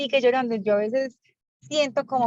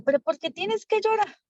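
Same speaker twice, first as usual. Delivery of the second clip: exasperated, pitched-up scolding of a crying son; retelling herself losing patience, voice climbing high; mild mock of a raised, impatient mother's voice; raising her voice; 8 semitones higher than usual. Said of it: "le voy como levantando la voz".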